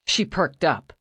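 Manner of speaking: usual speaking voice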